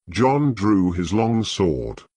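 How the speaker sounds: ordinary delivery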